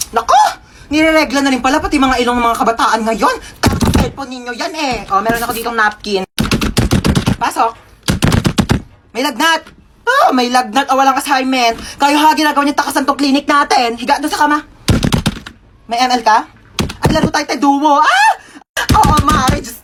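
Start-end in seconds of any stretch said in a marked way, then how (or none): 0.1-0.4 shocked exclamation. Acted. Nurse shocked at a nosebleed. 18.0-18.3 sudden shriek. Acted.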